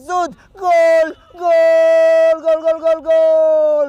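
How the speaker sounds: goal call. Real, not acted. Goal scored, commentator erupts.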